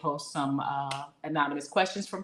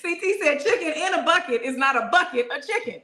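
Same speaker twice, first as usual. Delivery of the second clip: reading through laughter, pitched up; barely contain the giggles while quoting; laughing; 3 semitones higher, 4 dB louder than usual. Real, not acted. Host reading a teasing comment about her husband, laughing. Reads viewer comment, cracking up.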